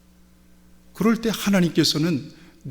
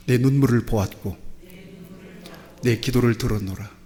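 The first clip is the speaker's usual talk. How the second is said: congregation declaration; 2 semitones lower than usual. Shown as a demonstration. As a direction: proclaim it for all to repeat. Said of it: "같이 고백합시다".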